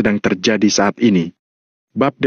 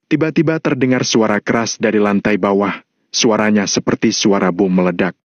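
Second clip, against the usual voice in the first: ordinary delivery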